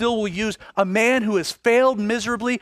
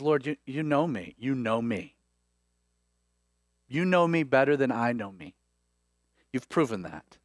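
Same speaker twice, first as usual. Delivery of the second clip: low, subdued, pained admission as Peter; grieved; 6 semitones lower, 6 dB quieter than usual. Acted. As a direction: answer softly, humbled and hurt. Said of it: "we're told that he's grieved".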